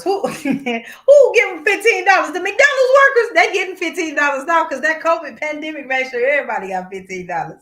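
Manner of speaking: high, loud laughing scoff; laughing disbelief. Real, not acted. Ranting to co-host about fifteen-dollar wages, loud laughing scoff. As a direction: cackle at the very idea, voice up high. Mocking fast food wages.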